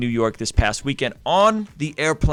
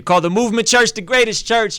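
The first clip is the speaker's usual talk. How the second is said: higher and louder, racing, proud and over the top; hyped-up boast; 10 semitones higher, 7 dB louder than usual. Real, not acted. Proudly hyping his church to the stream, excited. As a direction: oversell the church like a hype man. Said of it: "that's such a thing to say".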